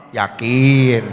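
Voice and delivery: low, unruffled question; acted patient husband; calm husband. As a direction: answer her yell in a low pitch. Acted calm reply to yelling wife.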